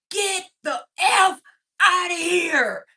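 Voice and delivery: high, loud, slow mock scream; imitated screaming. Gamer throwing a kid out, imitated screaming. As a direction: imitate a man screaming, each word stretched. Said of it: "He is screaming".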